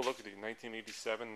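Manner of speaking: ordinary delivery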